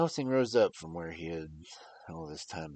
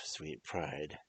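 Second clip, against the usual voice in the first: usual speaking voice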